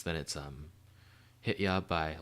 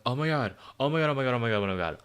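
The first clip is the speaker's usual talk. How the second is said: loud, higher, tumbling exclamation; excited outburst; 4 semitones higher, 7 dB louder, faster than usual. Real, not acted. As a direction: burst out as thrilling news hits you. Reacts to album news reminder.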